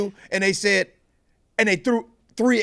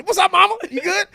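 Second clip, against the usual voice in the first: high, bright hello; cheerful greeting; 9 semitones higher, 3 dB louder, faster than usual. Real, not acted. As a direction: greet mama with excitement. Greeting listener's mother.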